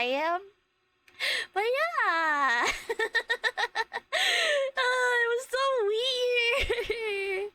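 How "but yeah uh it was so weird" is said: high, drawn-out, helpless laughing voice. Real, not acted. Streamer reacting to her awkward encounter, laughing. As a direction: squeal it through real laughter. Cracking up over her own story.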